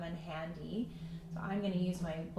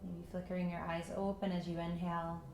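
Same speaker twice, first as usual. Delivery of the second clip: calm meditation voice with long pauses; unhurried, even guidance into stillness; guided meditation; 5 dB quieter than usual. Acted. Yoga teacher settling students into breath and patience, guided meditation.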